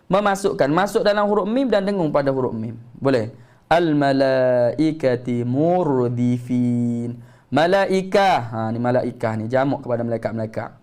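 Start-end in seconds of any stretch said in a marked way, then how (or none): 3.7-7.2 very slow melodic tajwid chant; Quranic recitation. Shown as a demonstration. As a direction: draw out each vowel reverently. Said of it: "recites verse in lesson".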